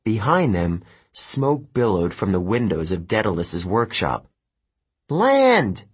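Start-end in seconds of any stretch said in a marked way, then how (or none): 5.1-5.8 high, drawn-out shout; yelled command. A narrator's reading. Girl warning her flying friends, yelling. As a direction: call the order across the sky. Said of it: "Annabeth yelled".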